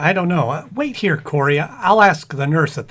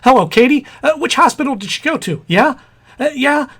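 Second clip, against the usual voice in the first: loud, high, tripping over words; flustered excitement; 6 semitones higher, 6 dB louder than usual. Acted. Anxious husband stammers on the phone to Katie, loud and flustered. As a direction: stammer into the phone in a frantic hurry. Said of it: "Getting excited won't help".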